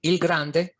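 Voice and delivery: "Il grande" said with Italian sounds; Italian word. Shown as a demonstration. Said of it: "meaning the big one".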